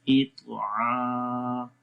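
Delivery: "इतआ" with a heavy, distinct "त" and long "आ"; careful twaa. Shown as a demonstration. Teacher asks learners to listen to the makhraj, "इतआ" carefully articulated. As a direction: articulate the "त" in "इतआ", then sustain "आ". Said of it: "त्वा को गौर से सुने".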